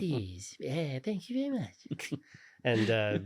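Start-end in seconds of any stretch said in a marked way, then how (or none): 0.0-2.1 imitated cartoon voice, softer and higher; Butters. Signing off a PA page, in imitated Butters voice. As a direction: softly finish the page in a put-on character voice. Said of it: "he would talk in voices 'cause".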